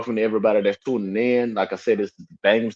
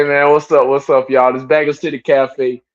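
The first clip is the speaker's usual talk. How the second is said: ordinary talk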